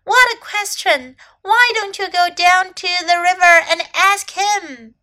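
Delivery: high, loud, mild amazement; amazed bird. A narrator's reading. Bird exclaims at the question.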